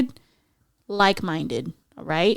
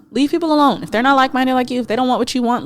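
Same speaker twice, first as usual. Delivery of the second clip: normal talking voice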